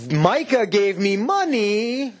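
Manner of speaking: hammed-up smug priest; telegraphing Levite. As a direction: stretch it out, imitating a man for sale. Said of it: "almost hear him making this gesture".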